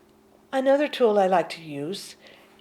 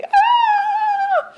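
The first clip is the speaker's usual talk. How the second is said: high startled cry; playful high shriek of the mind; mock shriek; 9 dB louder than usual. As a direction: yelp like the caught mind. Mind shocked out.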